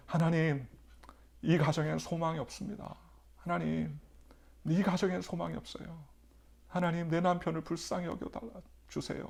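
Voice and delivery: wife's tearful prayer. Retold or imitated. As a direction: plead to God as the weeping wife, lightly voiced. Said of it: "하면서 막 통곡을 기도하더라는 거예요". (울며 기도하는 아내 흉내)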